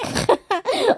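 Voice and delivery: a real, high, bubbling laugh; high burst of laughter. Real, not acted. Vlogger laughs at tripping over her words, high laughter.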